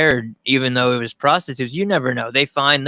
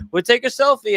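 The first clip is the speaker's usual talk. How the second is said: high chirpy quote; 10 semitones higher than usual. Retold or imitated. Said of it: "and the le".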